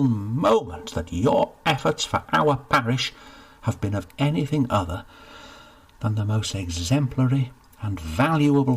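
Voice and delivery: flustered official heaping praise; gushing steward. Acted. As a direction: overdo the flattery. Steward effusively reassuring.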